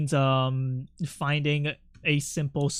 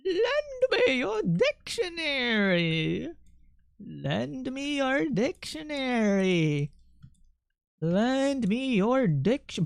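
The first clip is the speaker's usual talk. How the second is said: "Lend me your dictionary" high, soft and very slow, rising; specific cadence; 7 semitones higher, 3 dB quieter, much slower than usual. Shown as a demonstration. Repeating the code phrase to chat, slow and high. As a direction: croon it slow and high, like a spell. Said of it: "say it in that specific cadence".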